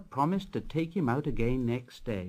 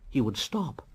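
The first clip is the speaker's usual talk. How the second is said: ordinary delivery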